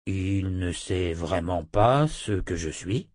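loup parlant incrédule. Acted. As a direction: ask slowly, rising at the end. (voix de loup, lente et grave)

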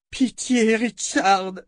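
high, wailing appeal; moaned plea. A narrator's reading. Callan collapses against Richard's legs, moaning for pity. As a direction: moan it out, begging for mercy. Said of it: "gémit-elle en s'écroulant contre ses jambes".

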